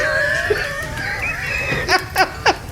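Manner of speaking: high howling laughter near a shout. Real, not acted. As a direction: break into helpless howling laughter. Outtake, both cracking up.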